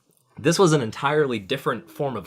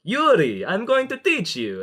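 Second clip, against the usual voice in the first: mock Russian accent; 12 semitones higher, 5 dB louder than usual. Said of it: "He's like".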